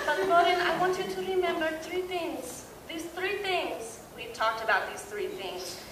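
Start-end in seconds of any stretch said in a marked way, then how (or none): 0.1-4.0 imitating grandma. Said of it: "Then enters Yaya".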